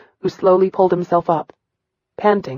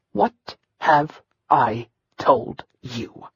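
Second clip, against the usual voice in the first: very slow, low, threatening; menacing question; 5 semitones lower, 4 dB quieter, much slower than usual. Acted. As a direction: drag it out like a threat. Commander menaces with the cane.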